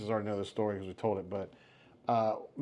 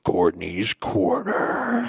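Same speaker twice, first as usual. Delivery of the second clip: slow, spooky show announcer; horror host; 4 semitones lower, 5 dB louder, much slower than usual. Acted. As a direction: draw it out like a creepy host. Announcing the segment ominously.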